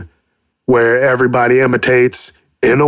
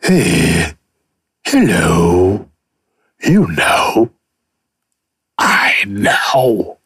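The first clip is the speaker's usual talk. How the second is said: low character voice; 5 semitones lower, much slower than usual. Pretend.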